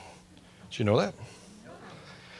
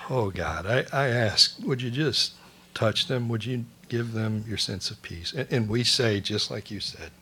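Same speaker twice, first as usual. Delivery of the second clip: quiet prayer; 2 semitones lower, 5 dB quieter than usual. Real, not acted. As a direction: pray gently over the congregation. Prayer for those in storms.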